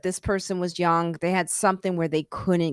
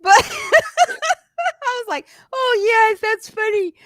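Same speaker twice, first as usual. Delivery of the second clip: laughing hard; 6 dB louder, much slower than usual. Real, not acted. Laughing through a quote.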